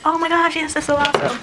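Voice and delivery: high, gushing congratulations; imagined thrilled reaction; excited for someone. Pretend. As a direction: squeal with delight for a friend, loud and high. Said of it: "If anybody else would have twins, I'd be like".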